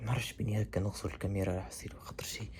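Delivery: low whisper to camera. Real, not acted. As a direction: whisper it under your breath. Whispering while others sleep.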